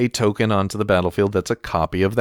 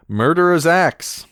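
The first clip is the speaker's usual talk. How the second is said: excited announcement; 10 semitones higher, 4 dB louder, slower than usual. Pretend. Card name announced loudly.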